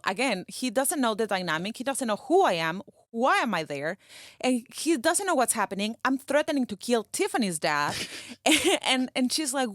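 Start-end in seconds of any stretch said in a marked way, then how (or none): none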